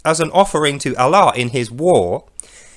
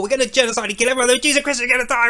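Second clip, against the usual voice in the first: mock frenzy; 6 semitones higher, much faster than usual. Ventriloquizing god's war speech.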